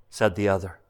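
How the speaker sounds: ordinary delivery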